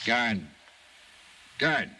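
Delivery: loud call for the guard. Acted. Detective calling the guard to the cell, loud call. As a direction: raise your voice to summon the guard down the hall.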